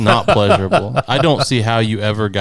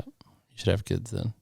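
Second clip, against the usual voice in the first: much softer, slow muttered aside; quiet repeat; 12 dB quieter than usual. Real, not acted. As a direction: say it again under your breath.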